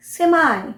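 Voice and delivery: सेमाई ending in a high, long "eye": SEM-eye; American pronunciation. Shown as a demonstration. Teacher showing how Americans say it, American pronunciation. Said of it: "ई को आई".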